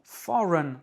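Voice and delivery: foreign said as FOR-un, reduced second syllable; English word foreign. Shown as a demonstration. Teacher models foreign for French learners. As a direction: stress FOR, weak run at the end. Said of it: "c'est for qui est accentué".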